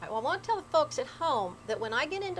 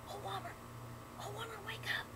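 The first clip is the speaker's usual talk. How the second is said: high frightened whisper; scared whisper; 11 semitones higher, 14 dB quieter than usual. Acted. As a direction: whisper urgently, terrified. Man whispers to wife in fear.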